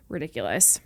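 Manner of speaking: ordinary delivery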